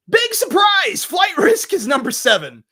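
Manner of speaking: loud, high-pitched announcement; big surprise reveal. Real, not acted. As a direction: shout out the surprise pick. Announcing a surprise list pick.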